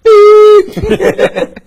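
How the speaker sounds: high beep like a bleep; mock censor bleep.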